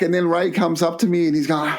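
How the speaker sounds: normal talking voice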